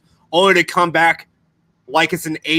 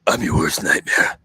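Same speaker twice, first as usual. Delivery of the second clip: much lower, menacing growl; mock tough guy; 11 semitones lower than usual. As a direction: drop into a hammed-up action-hero voice. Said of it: "Michael fucking was like".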